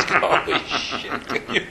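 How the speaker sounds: slow whispered disbelief with a laugh; a half-laughing whispered holy shit; amazed whisper. Retold or imitated. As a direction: breathe it out in awe. Reenacting shared look of disbelief.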